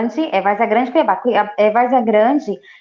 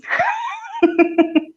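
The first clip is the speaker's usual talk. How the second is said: bright laugh. Real, not acted. Laughs about her age.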